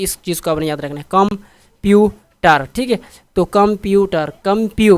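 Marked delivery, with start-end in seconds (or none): 1.1-2.7 computer very slowly, in three separate beats; syllable by syllable. Shown as a demonstration. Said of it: "तीन syllable बन गए". 4.4-5.0 कम प्यू with प्यू pushed harder; stress on प्यू. Shown as a demonstration. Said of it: "प्यू पर ज़्यादा जोर देते हैं".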